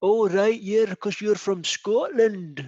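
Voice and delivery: put-on half-hearted Scottish accent aping the guest; mock Scottish accent. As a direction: tease him by copying his Scottish voice. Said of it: "are you taking the wee piss out of my voice".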